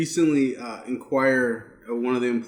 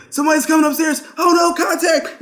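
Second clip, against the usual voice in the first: high, loud mock game callout; frantic callout; 14 semitones higher, 5 dB louder than usual. Calling out an attack to teammates, frantic. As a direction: shout it like an alarm to teammates.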